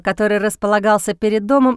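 ordinary delivery, nothing marked

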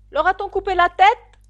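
high, very loud demand; shouted question. A narrator's reading. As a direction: yell the question as a queen. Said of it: "cria la reine". (question criée d'une voix aiguë)

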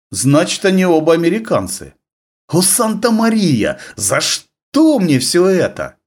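slow, high despairing sigh; drawn-out plea to the saints; exasperated lament. Acted.

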